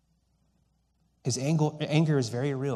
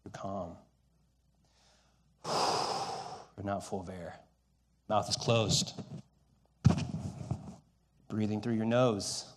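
calm and quiet; 6 semitones lower, 6 dB quieter than usual. Shown as a demonstration. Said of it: "what's the opposite of that".

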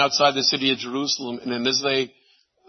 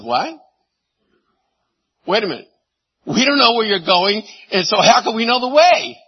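rising, louder puzzled disciple; puzzled Thomas; 7 semitones higher, 4 dB louder than usual. Retold or imitated. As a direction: ask it like a baffled follower. Voicing confused Thomas in sermon.

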